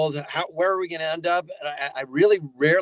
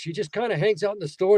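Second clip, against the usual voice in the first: ordinary delivery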